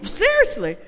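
usual speaking voice

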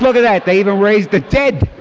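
loud and high, amazed. Retold or imitated. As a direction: call it out like a miracle just happened. Joking remark at revival.

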